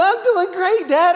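put-on five-year-old answering her teasing dad; small daughter. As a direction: play a happy little girl. Daughter voiced in story.

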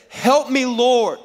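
high-pitched, urgent call upward; acted cry for help. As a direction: call out for rescue from the depths. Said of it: "from deep within the realm of deep, inside a well".

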